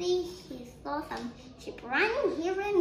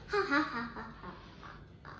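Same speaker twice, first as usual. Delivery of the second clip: quick, staccato acted "ha ha"; put-on prankster's chuckle; mischievous laugh; 4 dB quieter, much faster than usual. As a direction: laugh like a prankster, trailing away. Boy plots the prank again.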